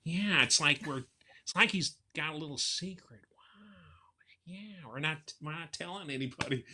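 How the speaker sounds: dreamy surfer voice sharing a secret; Owen Wilson impression. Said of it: "Owen Wilson's another, he's always going".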